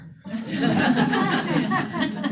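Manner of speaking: high delighted laugh bursting out; burst of laughter. Real, not acted. Guide laughing at her own rock star line. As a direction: laugh out loud at the thought.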